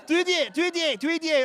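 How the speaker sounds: high-pitched foreign cashier yelling a price; mock yell, foreign accent. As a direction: shout it high, slurred to sound like "you idiot". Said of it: "She's yelling, uh, screaming".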